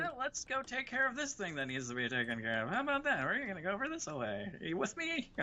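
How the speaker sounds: imitated eager leader, pitch leaping up on questions; rally the group, swinging high at each question; animated mock pep talk; rallying teammate. Imitated teammate suddenly rallying everyone.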